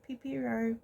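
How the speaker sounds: Pipero as pee-PEE-roh; guessed pronunciation. Shown as a demonstration. Vlogger settling on a guess at the name, Pipero as pee-PEE-roh. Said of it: "No, it's gotta be".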